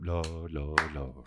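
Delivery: low, very soft Lord murmurs; mock congregation murmuring; background murmur. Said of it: "everyone in the background going".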